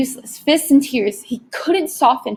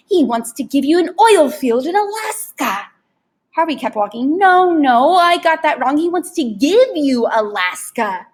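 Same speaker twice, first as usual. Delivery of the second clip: higher, louder huckster voice; pushy tempter Rictus; 5 semitones higher, 4 dB louder than usual. Acted. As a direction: raise the bid like an auctioneer. Rictus raising the offer.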